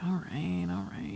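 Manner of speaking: soft, grudging mock surrender; Albus. Co-host voicing the dog told to lay down, resigned. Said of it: "It's like".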